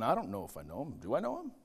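quiet, unsure mumble, rising at the end; confused believer. Retold or imitated. Doubting believers in church, quietly unsure. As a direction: voice a doubting churchgoer asking himself. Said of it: "a bunch of confused people that are going".

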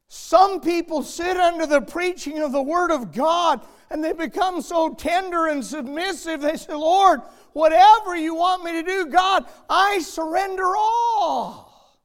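loud, high, ringing preacher's climb; preaching at full force. Acted. Pastor crying out to the congregation, full preaching force. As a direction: preach it out to the rafters.

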